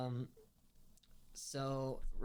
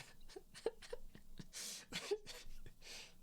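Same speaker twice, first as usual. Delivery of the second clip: helpless laugh; 7 dB quieter than usual. Real, not acted. Laughing at his own retold joke.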